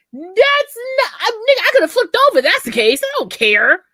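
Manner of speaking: loud, high, protesting outburst; indignant retort. Retold or imitated. Woman retelling her comeback to a sulking lover, indignant retort. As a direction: fire back at an accusation, high and loud. Acted retort in bedroom quarrel.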